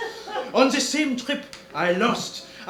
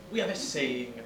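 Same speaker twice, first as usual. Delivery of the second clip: ordinary talk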